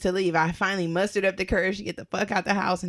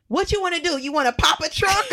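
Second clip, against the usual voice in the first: high, loud mock confrontation; raised-voice, incredulous challenge; indignant challenge; 9 semitones higher, 3 dB louder than usual. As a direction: call him out at a higher pitch. Retold challenge to suspected prowler.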